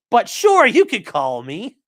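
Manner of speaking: squeaky, loud mock of a careless reply; mock him in a high, loud voice, then drag out "you could call me"; mocking the ambassador. Mocking the ambassador's sloppy reply to the undercover agent, high and loud. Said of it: "that's why he's saying".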